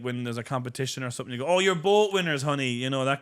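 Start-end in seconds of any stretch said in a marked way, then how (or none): 1.5-2.7 higher, louder parent's reassurance; hammed-up consoling parent voice; mock doting parent. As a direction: gush it to the children. Parent to kids, acted out.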